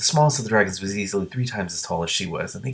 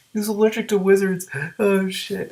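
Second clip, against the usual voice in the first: much higher, delighted realization; amused surprise; 11 semitones higher than usual. Real, not acted. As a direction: squeal at the joke. Reader laughs at dragon allergy.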